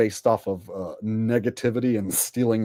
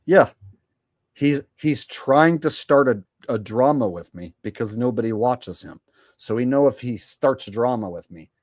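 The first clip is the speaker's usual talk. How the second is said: ordinary delivery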